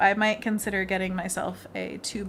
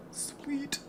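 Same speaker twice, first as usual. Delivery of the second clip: soft breathy whisper; 6 semitones higher, 9 dB quieter than usual. Real, not acted. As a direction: whisper it to yourself. Whispered aside while painting.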